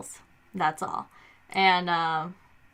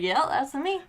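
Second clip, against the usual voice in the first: lightly put-on country accent, pitched higher; higher-pitched mock country dad voice; 6 semitones higher than usual. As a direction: imitate dad with a hint of country twang. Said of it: "I don't know where that accent came from".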